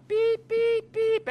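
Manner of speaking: high, loud monotone beeps; imitated timer beeps. Businessman counting down for an answer, imitated beeps. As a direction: mimic an electronic timer, high and even.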